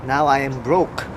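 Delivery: ordinary talk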